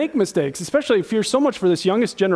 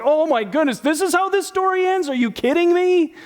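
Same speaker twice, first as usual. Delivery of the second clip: mock disbelief; 8 semitones higher than usual. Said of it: "It's like".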